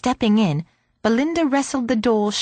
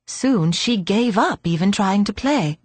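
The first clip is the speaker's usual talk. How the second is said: ordinary delivery, nothing marked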